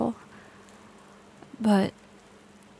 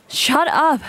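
snap; 5 semitones higher, 6 dB louder than usual. Acted. Snaps at captive.

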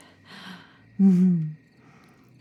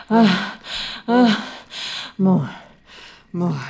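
aroused moans. Acted. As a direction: moan with growing need. Moaning, +4 to +5 dB.

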